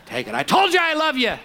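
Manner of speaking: high, loud, exasperated mock gift-giving with a laugh; grudging husband. Husband grudgingly handing his wife a birthday gift. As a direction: shove the gift over, annoyed. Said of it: "out of a grudging heart".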